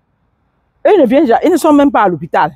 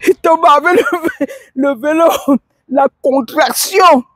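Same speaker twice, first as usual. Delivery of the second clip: laughing while telling; 7 semitones higher than usual. Real, not acted.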